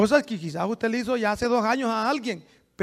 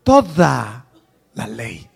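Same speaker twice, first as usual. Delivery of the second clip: slow, solemn, louder; scripture reading; 3 semitones higher, 5 dB louder than usual. Acted. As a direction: proclaim the commandment solemnly. (proclamando la Escritura con solemnidad)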